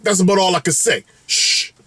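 ordinary delivery

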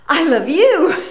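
high, loud, overjoyed I love you; gushing reply. Retold or imitated. Telling the clerk she loves him back, gushing.

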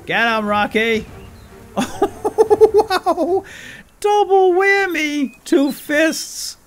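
high, loud excited yelling; excited shouting. Real, not acted. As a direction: cheer the pet on at full volume.

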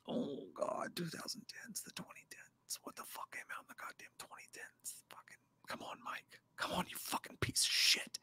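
frantic whispered cursing under breath. Real, not acted. Streamer panicking over a horror quiz, whispering. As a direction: whisper to yourself in panic, racing for an answer.

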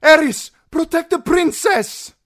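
very high, loud command; full-voiced alarmed yell; urgent cry. A narrator's reading. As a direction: shout an urgent order to the knight. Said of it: "she cried".

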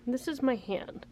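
usual speaking voice

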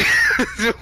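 very high short squeal of laughter. Real, not acted.

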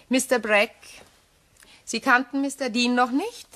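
usual speaking voice

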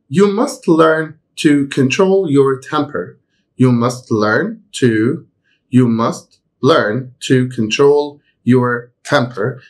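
slow, careful example reading; slow model sentence. Shown as a demonstration. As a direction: read it slowly for students to follow. Teacher reads example sentence slowly. (جملة مثال ببطء ووضوح)

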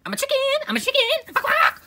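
very high, loud squawking mimic; mocking imitation. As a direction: squawk it back at them. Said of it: "you always say".

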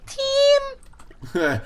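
very high drawn-out squeak; mock the squeaky way he said it. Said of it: "said team effort and went".

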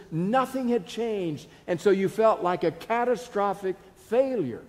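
full-force preaching on failure. Acted. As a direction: drive it home to the pews.

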